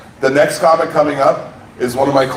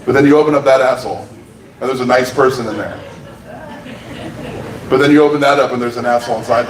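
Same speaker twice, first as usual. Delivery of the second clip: ordinary delivery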